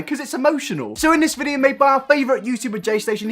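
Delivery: ordinary delivery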